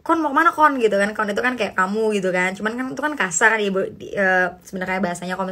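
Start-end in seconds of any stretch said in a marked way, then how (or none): 0.1-0.8 rough East Javanese. Shown as a demonstration. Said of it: "cuman kayak".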